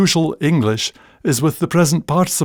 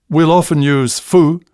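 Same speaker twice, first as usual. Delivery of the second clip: normal talking voice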